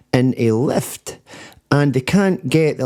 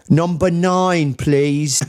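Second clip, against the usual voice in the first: slow, higher put-on English accent; 4 semitones higher, much slower than usual. Shown as a demonstration. Scot talking to voice recognition, hammy fake English accent. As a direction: draw it out in a badly faked English accent.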